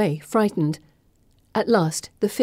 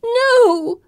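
high, loud desperate wail; 7 dB louder than usual. Acted. Pip wailing at the girls who fear her. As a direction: wail it out in despair. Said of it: "Pip wails".

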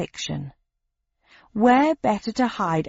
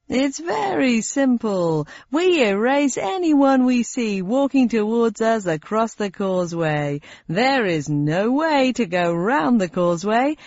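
slow, higher cheery quiz host; game show host voice; 3 semitones higher, slower than usual. Acted. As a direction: explain deadly rules brightly. Host explains deadly rules.

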